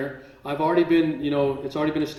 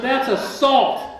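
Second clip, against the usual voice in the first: laughing protest; 9 semitones higher, 8 dB louder, much slower than usual. Retold or imitated.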